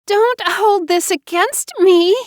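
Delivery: high, slow, loud sobbing plea. Acted. Wife begging her husband, high slow sob. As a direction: beg through sobs. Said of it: "she sobbed".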